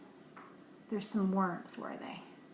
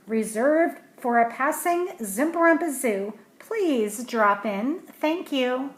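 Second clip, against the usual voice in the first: louder, slow sign announcement; sign read aloud; 3 semitones higher, 8 dB louder, slower than usual. Acted. Parent reading a painted sign in a storybook, louder and slow. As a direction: proclaim the sign like a grand notice.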